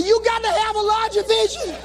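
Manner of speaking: high, strained, tearful insistence; cling to the speech while falling apart; mock distraught preaching. Jilted motivator being pulled off stage, distraught. Heartbroken, refuses to leave the stage.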